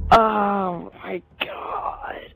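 slow, breathy whisper near tears; tearful whisper. Real, not acted. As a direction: barely get it out, choked up. Overwhelmed fan near tears.

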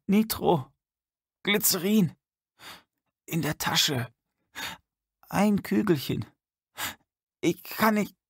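put-on high, gasping croak of a frail old man. A narrator's reading. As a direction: croak each word out with your last breath. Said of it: "krächzte der Alte mit letzter Kraft".

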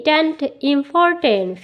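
"important" drawn out syllable by syllable, very slow; teacher stretching "tent, important" for students; slow syllable demo. Shown as a demonstration. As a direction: build "important" slowly from "tent". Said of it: "A N T".

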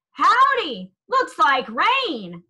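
loud high cowboy greeting; yelled over the quartet. A narrator's reading. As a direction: shout it over the singing. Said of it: "Holler yelled over the singing".

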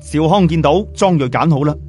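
plain reading voice

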